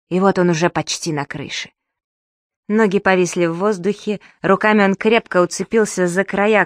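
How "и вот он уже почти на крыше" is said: usual speaking voice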